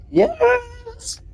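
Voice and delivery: very high drawn-out squeal; long, high-pitched celebratory cheer; celebratory yes. Real, not acted. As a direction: squeal it out in triumph. Celebrating reaching rank eighteen.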